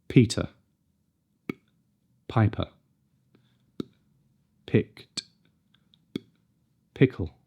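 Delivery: aspirated "Peter", "Piper", "Picked", "Pickle"; each p crisp and clean; crisp p sounds. Shown as a demonstration. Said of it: "Nice and clean and crisp".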